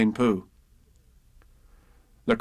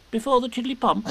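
puzzled Piglet; 12 semitones higher than usual. Acted. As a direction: question it like a confused piglet.

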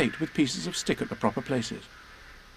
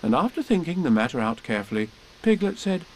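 plain reading voice

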